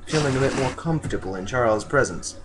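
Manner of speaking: plain reading voice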